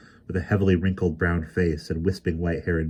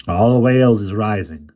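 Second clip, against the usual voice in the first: loud lilting proclamation; 4 semitones higher, 8 dB louder than usual. Acted. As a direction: sing it out with fervour. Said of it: "a lilt came into his voice as if he were singing".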